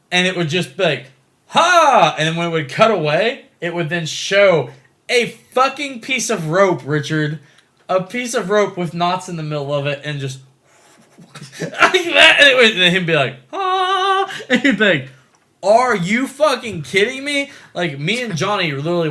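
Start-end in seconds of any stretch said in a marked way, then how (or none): 1.5-2.1 loud, high "ha" burst; imitated attack cry. Imitating hero firing his web. 13.5-14.3 high, loud sustained "ah"; drawn-out mock battle cry; imitated hero yell. 15.6-17.5 loud, high, slow incredulity; outraged disbelief. Retold or imitated. Retold viewer's outraged disbelief.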